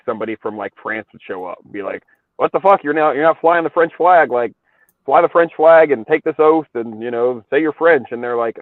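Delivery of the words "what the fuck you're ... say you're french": louder, higher retold scolding; mock demand. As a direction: order them about in mock exasperation. Voicing bossy French envoy.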